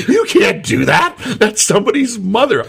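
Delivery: high, laughing mock protest. Storyteller objects to the bartender in a high laughing voice. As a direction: squeak out a shocked objection. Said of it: "I'm like".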